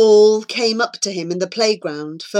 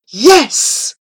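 loud, raised yes; excited cheer; 6 semitones higher, 9 dB louder than usual. A narrator's reading. Mosen cheering Flora's science plan, excited. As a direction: punch it out in triumph.

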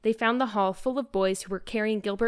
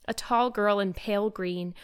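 ordinary delivery, nothing marked

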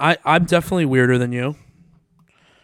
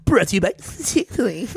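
mild mock ad announcer, pitched higher; 6 semitones higher than usual. Imitating a radio announcer's sponsor line. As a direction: read it like a radio sponsor tag. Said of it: "the voice of the announcer".

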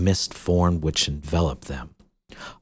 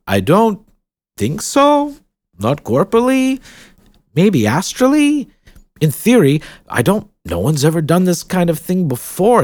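higher, musing theorist; lightly voiced baron; 7 semitones higher, 6 dB louder than usual. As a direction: ponder the theory aloud.

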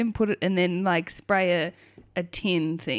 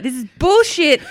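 loud, higher mock indignation; retold angry outburst; 6 semitones higher, 7 dB louder than usual. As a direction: imitate someone cracking it. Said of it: "he cracked the shit".

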